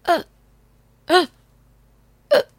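little hiccup sounds. Acted. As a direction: hiccup each one. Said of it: "只能听到比诺打嗝的声音".

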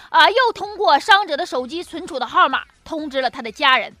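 ordinary talk, nothing marked

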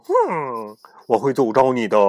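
loud stern threat. Acted. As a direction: threaten him harshly. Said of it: "语气严厉地说".

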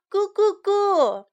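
put-on high little baby coo. Storyteller voices baby's coos in Chinese, high babble. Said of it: "宝宝就说".

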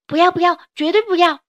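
put-on high, loud child's refusal; a kid refusing to leave dad; child protesting. 孩子哭着拒绝. (小孩子大声哭喊不要)

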